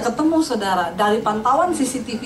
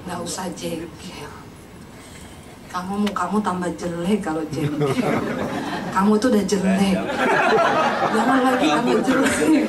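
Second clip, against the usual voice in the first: gentle inner voice; 6 semitones lower than usual. Retold or imitated. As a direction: murmur it like a kindly conscience. Inner voice of God teasing.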